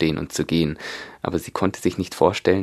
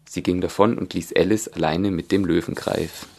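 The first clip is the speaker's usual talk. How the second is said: plain reading voice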